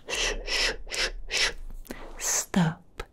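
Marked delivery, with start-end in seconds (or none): 0.1-1.5 quick repeated "shh"; hushing shushes. Acted. Vampire hushes a captive partner, breathy shushing. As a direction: hush them with short breathy shushes.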